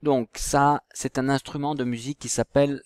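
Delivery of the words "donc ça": usual speaking voice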